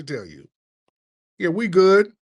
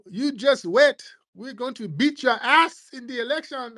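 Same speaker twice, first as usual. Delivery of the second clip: high, cocky put-on voice, much higher; mock immigrant trash talk; 11 semitones higher than usual. Immigrant boasting before the election in a high taunting mock voice. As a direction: taunt it smugly.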